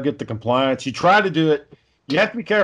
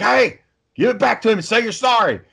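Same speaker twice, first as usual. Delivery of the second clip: raised, much higher scolding voice; imitating himself telling off a child; stern dad; 9 semitones higher than usual. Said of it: "that's when I get really pissed off".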